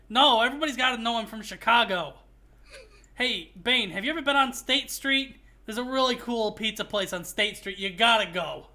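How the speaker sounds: put-on loud Chicago accent talking to Bane; thick Chicago accent. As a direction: play the heist guy with a thick Chicago accent. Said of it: "Houston's Chicago accent is so thick".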